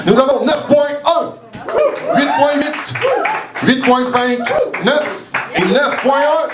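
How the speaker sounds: high-pitched emcee calling out scores; excited high call of the judges' marks; score announcement. Real, not acted. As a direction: ring each number out high over the crowd. Host calls out jury's scores.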